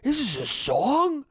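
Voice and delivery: lightly voiced bewildered Italian dad; mock confused dad. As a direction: play a puzzled old man hearing the song. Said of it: "the ones who are like".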